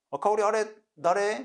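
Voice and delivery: probing question. Acted. Friend asks Kaori about the man, high probing question. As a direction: ask a friend, pitched right up.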